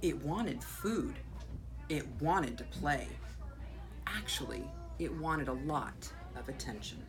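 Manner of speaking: expressive read-aloud with swelling key words; animated picture-book reading with deliberate pauses; story narration. A narrator's reading. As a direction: read to children, punching the important words. Reads picture book aloud to children.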